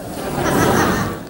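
breathy laugh under his breath; soft laugh. Real, not acted. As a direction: chuckle softly. Laughs again.

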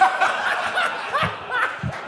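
high unvoiced wheeze of laughter; silent wheezing laugh. Real, not acted. Laughing at his own story.

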